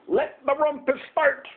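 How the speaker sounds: high, excited proclamation; Max crying out. A narrator's reading. Max crying out to the wild things, high proclamation. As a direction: proclaim it high, like a young king. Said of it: "cried Max".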